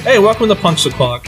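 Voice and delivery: ordinary delivery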